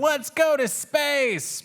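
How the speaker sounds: very high, slow, stretched cheer; excited Waffles. A narrator's reading. Kitten rallying his sister toward space, high slow cheer. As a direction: draw it out high like a rallying cry. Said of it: "And Waffles says".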